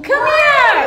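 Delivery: high, loud come-here; loud call. Real, not acted. Mother calling her runaway toddler back, loud and high.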